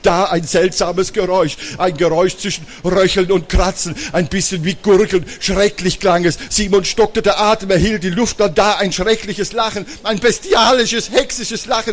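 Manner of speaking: high, tense storytelling; suspenseful story narration. Acted. Storyteller tells children of hideous noises in the hall, suspenseful.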